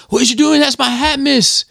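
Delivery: high-pitched mock schoolboy protest; mimicked school troublemaker. Retelling a schoolboy challenging his teacher, high mock-indignant voice. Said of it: "and he's like".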